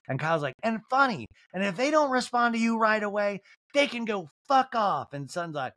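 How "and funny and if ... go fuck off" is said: Kyle impression. Recapper voices Kyle.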